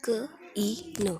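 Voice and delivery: "क, ई, न" said as three separate letters; wrong letter-by-letter reading. Shown as a demonstration. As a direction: sound out "क, ई, न" without joining the vowel sign. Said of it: "ये गलत है". ("क, ई, न" अलग-अलग अक्षर बोलना)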